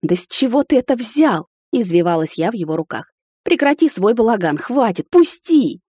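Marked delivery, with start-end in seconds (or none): 0.0-1.5 high-pitched, incredulous challenge; indignant protest. A narrator's reading. Woman squirming in his arms, disputing him indignantly. 3.4-5.8 high protest rising to a loud cry; exasperated demand. A narrator's reading. Woman struggling in the captain's arms, exasperated demand.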